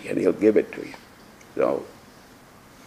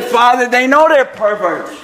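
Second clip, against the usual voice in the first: laughing; 13 semitones higher than usual. Retold or imitated. Own reply told while laughing.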